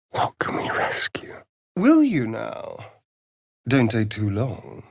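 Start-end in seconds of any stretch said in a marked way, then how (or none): 0.1-1.4 whisper. Acted. Urgent search, whispered.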